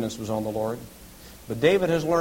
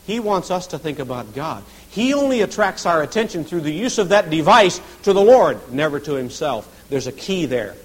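ordinary talk, nothing marked

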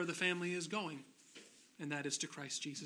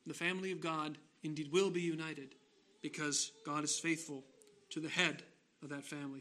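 ordinary delivery

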